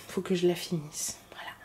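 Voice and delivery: soft whispered aside; whispering. Real, not acted. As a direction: whisper it to yourself. Aside about finishing the pie.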